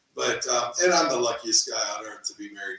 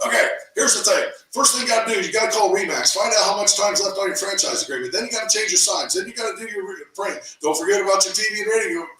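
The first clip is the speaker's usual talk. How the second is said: urgent coach; 8 semitones higher, 3 dB louder, faster than usual. Retold or imitated.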